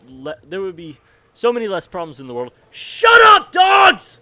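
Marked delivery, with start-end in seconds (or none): 2.7-4.2 real shout, much higher and louder; shouting at dogs. Real, not acted. Speaker interrupts himself to yell at noisy dogs.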